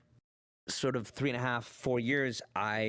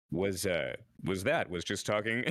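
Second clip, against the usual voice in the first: put-on older person voice; 3 semitones lower than usual. Shown as a demonstration. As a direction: lower put-on grown-up voice. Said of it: "being an older person".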